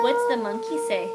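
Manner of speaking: excited prompt. Real, not acted. Prompting toddler.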